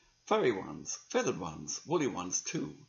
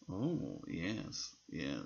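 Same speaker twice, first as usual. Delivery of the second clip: ordinary delivery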